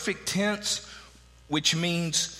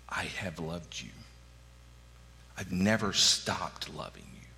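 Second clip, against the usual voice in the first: tender; 10 semitones lower, 5 dB quieter than usual. Acted. God's voice, tender quote.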